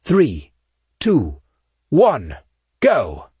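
spaced, punchy count; commanding countdown. Acted. Saint counts the Marines into pushing the door, commanding. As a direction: count the squad into action.

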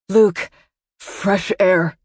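strained, narrated effort to speak; struggling to get out. A narrator's reading. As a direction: force the words out between breaths. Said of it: "he got out".

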